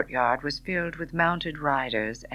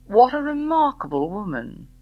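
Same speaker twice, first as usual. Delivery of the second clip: admiring gentleman; 9 semitones higher, 6 dB louder than usual. A narrator's reading. Bart voiced.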